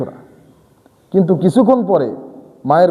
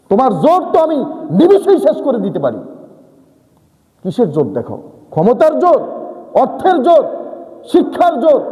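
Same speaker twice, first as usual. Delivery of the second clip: rebuke; 7 semitones higher than usual. Acted. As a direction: hammer each kind of strength. Preacher rebuking the listener's pride.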